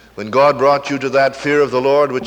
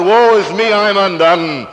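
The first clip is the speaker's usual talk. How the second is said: loud, high cry of a broken sinner; 8 semitones higher, 9 dB louder than usual. Acted. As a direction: cry out your ruin to God, loudly. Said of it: "They've never cried out".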